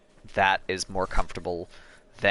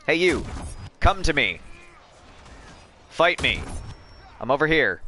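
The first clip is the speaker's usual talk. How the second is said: taunting call; 5 semitones higher than usual. Pretend. Taunting enemies in game.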